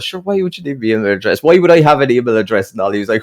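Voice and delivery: high, loud retold protest; mock indignant. Customer objecting to salesman asking for his email, mock indignant. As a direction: imitate a customer baffled and put out. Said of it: "he must have ripped into the poor young lad".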